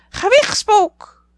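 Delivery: heel hoog, luid en traag gekrijs; grijnzende papegaai. A narrator's reading. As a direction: krijs het traag uit als papegaai. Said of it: "grijsde Polly". (papegaai Polly krijst heel hoog en traag)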